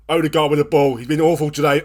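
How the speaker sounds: ordinary delivery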